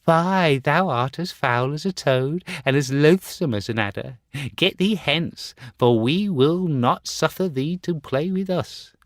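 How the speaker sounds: put-on high, loud, jeering children's voice; mocking children. Playmates jeering at the Star Child, high and mocking. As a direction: taunt the ugly boy away. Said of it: "they mocked him".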